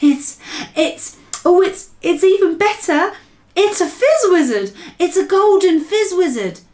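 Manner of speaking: high, loud, breathless delight; very excited. Acted. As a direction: giant stammering with joy at his catch. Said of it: "The B F G was very excited".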